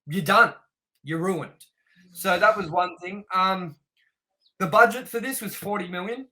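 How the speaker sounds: ordinary talk, nothing marked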